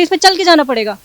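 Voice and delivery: mock the guard's harsh command; rude staff order. Staff member insisting she walk over the flag, loud imitated order. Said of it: "उसी tone में बताएं".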